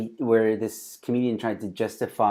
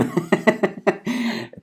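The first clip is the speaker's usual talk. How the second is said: high laugh; 11 semitones higher, 4 dB louder than usual. Real, not acted. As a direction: giggle up high, breaking off. Laughs at donation amount.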